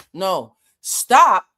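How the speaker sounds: loud, firm halt; firm command to stop. Retold or imitated. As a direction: cut him off firmly. Story, stopping the painter.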